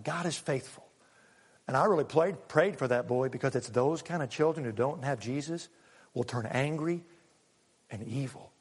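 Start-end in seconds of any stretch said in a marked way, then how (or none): none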